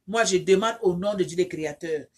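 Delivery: normal talking voice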